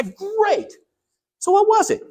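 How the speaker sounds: mock eager. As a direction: pounce on the answer with glee. Son eagerly asking secret.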